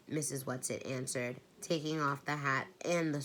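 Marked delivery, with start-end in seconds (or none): none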